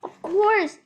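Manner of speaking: high, loud firm promise; reassuring Horton. A narrator's reading. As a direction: promise it firmly. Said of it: "Horn answered".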